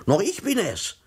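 high indignant outburst; getting worked up. A narrator's reading. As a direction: bristle with offended importance. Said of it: "ereiferte sich Herr Tomsa". (hoch und empört aufbrausend)

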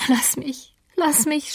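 mildly sobbing plea. A narrator's reading. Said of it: "schluchzte Catherine".

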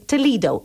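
"Toledo" as tuh-lee-doh; "Toledo" with a long ee; English pronunciation. Shown as a demonstration. Teacher repeats the English "Toledo", tuh-lee-doh.

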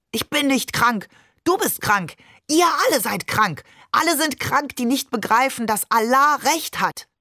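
high-pitched angry rant, contained volume; screaming. A narrator's reading. Father lashes out at being called ill, screaming. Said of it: "Und dann schrie er los".

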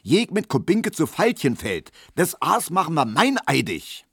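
high, agitated declaration; fired-up calling. A narrator's reading. Tesch making the case his own, fired up. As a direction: swing each phrase up with zeal. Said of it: "rief Tesch wieder".

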